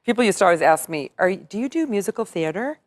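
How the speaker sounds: ordinary talk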